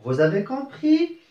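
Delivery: rising question tune on compris; question intonation. Shown as a demonstration. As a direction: let the voice climb on "compris?" to make it a question. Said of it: "avec l'intonation de la voix".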